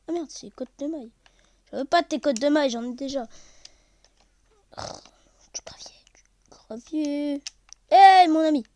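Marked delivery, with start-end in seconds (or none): 4.8-7.4 greedy whisper. Real, not acted. Gamer spotting gravel, whispering eagerly. Excited find in game. 7.9-8.6 loud high call; hearty greeting. Real, not acted. Gamer greeting a villager, loud and bright.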